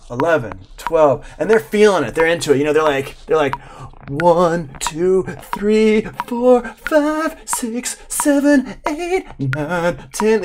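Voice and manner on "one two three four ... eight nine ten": counting in far up high, a dreamy falsetto. Retold or imitated. Mimicking the Radiohead singer counting in, high and airy. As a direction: float the numbers way up like a moody rock singer.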